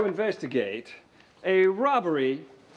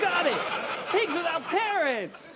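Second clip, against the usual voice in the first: high, eager shout of triumph; excited guess; 11 semitones higher than usual. Acted. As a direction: blurt out the solved clue. Charades guess solved.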